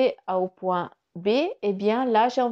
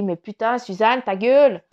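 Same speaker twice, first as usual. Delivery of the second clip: louder mock outburst, half-joking; telling herself off; 8 dB louder than usual. Shutting up her own inner critic, a loud half-joking outburst. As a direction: snap it at yourself like an exasperated friend. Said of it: "j'ai dit".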